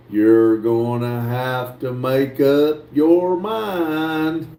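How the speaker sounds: slow, low, stretched-out words; slow drawl. Pretend. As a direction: drag each word out on purpose. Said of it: "You talk too slow".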